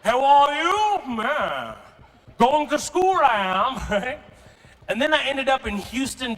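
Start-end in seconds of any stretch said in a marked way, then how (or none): 0.0-3.8 exaggerated British accent with swooping pitch; British English accent. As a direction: play a prim British English schoolboy. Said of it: "I spoke British English".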